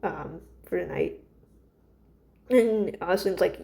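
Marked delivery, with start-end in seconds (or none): 0.0-1.2 softer, shaky pleading request; shaky plea. Retold or imitated. Frightened Cheddar shakily begs Celine to stay, soft and trembling. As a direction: beg her to stay, barely holding together. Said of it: "he, very shakily".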